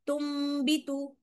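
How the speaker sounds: तुम भी with a light nasal hum, lips not fully shut; slow, say तुम भी through the nose without closing the lips; तुम भी with ghunna, loosely closed lips; nasal recitation. Shown as a demonstration. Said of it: "थोड़ा सा गुन्ना भी किया".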